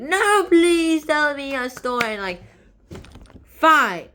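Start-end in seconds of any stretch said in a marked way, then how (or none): 0.0-2.1 loud, slow, high hammed-up plea; insistent begging. Lady begs after being refused. 3.4-4.1 loud acted "fine"; exasperated giving-in. Storyteller gives in to begging.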